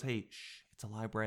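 soft mimed library shush; hushed shushing. Retold or imitated. Someone scolds the loudmouth in a library, hushed. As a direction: drop your voice and shush them.

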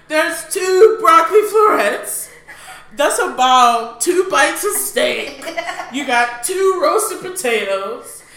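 high, loud, slow sarcastic listing; singsong ridicule of dad's leftovers; mocking singsong. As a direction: spell out the tiny leftovers with laughing scorn. Mocking father's tiny leftovers.

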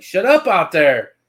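mock-bossy shout at the people outside. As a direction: bark it like a bossy friend, half joking. Zach snaps at noisy crowd.

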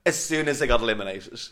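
ordinary talk, nothing marked

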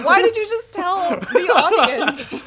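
high, loud laughing protest. Real, not acted. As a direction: laugh through the words. Laughing reaction to ants.

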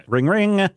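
phone ringing noise. Acted.

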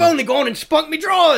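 hammed-up cockney, pitched high; mock cockney. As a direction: belt it out as a cheeky cockney. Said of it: "that's what they say".

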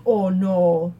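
mom and daughter's Wisconsin "Oh, no"; long rounded Wisconsin O in "no"; thick Wisconsin accent. Retold or imitated. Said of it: "my mom has a really thick accent".

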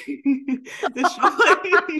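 can barely get it out for laughing; giggling, drawn-out repeat. Real, not acted. Laughing at the memory.

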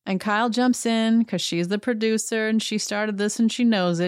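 usual speaking voice

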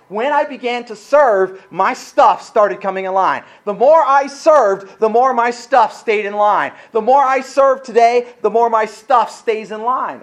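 loud, slow, rousing preacher cadence; building sermon climax, loud and slow; full-force preaching. Real, not acted. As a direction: preach it out to the congregation.

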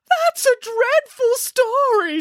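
high, slow, drawn-out wail; upset wail. A narrator's reading. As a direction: wail about the awful ending. Upset listener Boris reacts.

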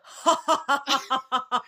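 loud, high staccato fake laugh; mock maniacal laugh. Imitating Ricky laughing as he walks, mock laugh. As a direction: imitate a crazed movie laugh. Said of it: "like, laughing".

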